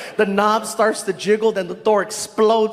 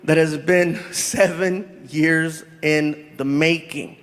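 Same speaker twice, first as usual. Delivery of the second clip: announcer; 6 semitones lower than usual. Acted. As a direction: introduce the guest like a host. MC introducing guest.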